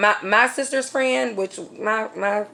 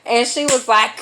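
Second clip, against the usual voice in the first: ordinary talk, nothing marked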